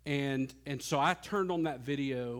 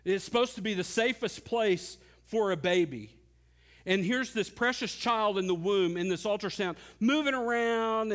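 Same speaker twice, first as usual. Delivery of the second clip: rising sermon storytelling; 5 semitones higher, 3 dB louder than usual. Acted. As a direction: pitch climbing higher as the scene builds. Builds the ultrasound scene.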